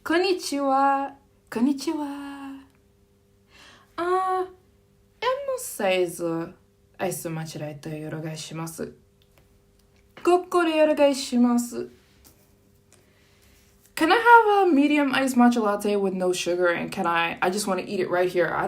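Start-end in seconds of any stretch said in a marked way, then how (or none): 0.0-11.9 higher, sing-song polite Japanese; demo Japanese order. Shown as a demonstration. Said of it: "you could say". 13.9-16.7 polite customer. Shown as a demonstration.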